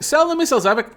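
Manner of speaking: high-pitched pestering viewer; voicing an insistent chat message; urgent mock demand. Voices chat's demand.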